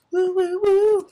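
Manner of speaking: very high, loud whoops; high whooping. Real, not acted. Player celebrating the nether trip, high whooping. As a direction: falsetto cheering whoop. Cheering on setting off.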